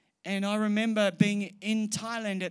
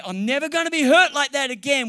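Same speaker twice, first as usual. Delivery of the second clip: defiant vow; 8 semitones higher, 13 dB louder, faster than usual. Retold or imitated. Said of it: "we say".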